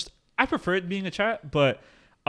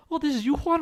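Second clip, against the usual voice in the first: put-on higher, sweet girl voice on the phone; imitating the caller; 11 semitones higher than usual. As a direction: play the cute caller recognising him. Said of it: "she was like".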